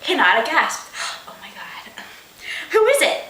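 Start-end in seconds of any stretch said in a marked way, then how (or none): 1.1-2.0 breathless stunned whisper; shocked whisper. Acted. Unvoiced gasp whisper. 2.7-3.2 eager question. Acted. Excited character question.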